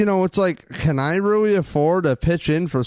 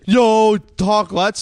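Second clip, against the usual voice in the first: louder and higher, eager "Yo, talk."; excited; 6 semitones higher, 6 dB louder, much slower than usual. Real, not acted. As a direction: jump in hyped to hear more. Eager to discuss Earl Thomas.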